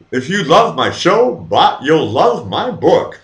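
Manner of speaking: high, slow, loud put-on pitch; mock salesperson, stretched and singsong; fake sales-pitch voice. Shown as a demonstration. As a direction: sell it in a drawn-out, hammed-up fake voice. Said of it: "That's the best I can muster up".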